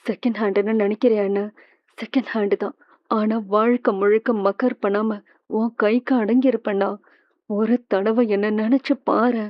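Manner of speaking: soft, pleading voice, like a whisper on the phone. Acted. Woman softly pleading with Krishna over the phone. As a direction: coax him gently and intimately.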